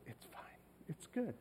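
barely audible, imitated reassurance; hushed little retold reply; forgiving daughter. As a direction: softly brush off an apology. Daughter brushing off apology, retold.

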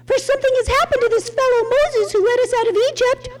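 high, fast whiny mimic of the crowd; mock the people's demand, high and quick; Aaron mimicking the crowd, high and hurried. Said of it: "They said to me".

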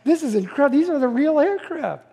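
high-pitched, gushing retold enthusiasm; excited awe. Dad showing his kids famous aircraft, acting out excited awe. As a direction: act out geeking out over the planes. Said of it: "I'm speaking like that to the kids".

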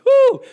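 loud, high-pitched whoop; excited whoop. Acted. Preacher reacting to scripture, excited whoop. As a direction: whoop it out with sudden glee. Whoops over delighting in commandments.

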